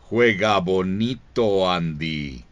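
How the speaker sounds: usual speaking voice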